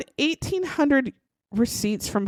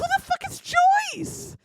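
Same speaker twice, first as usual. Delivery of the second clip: high, disbelieving cop demanding an answer; mock incredulous cop. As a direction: squeak it out like the cops can't believe it. Police baffled by second woman.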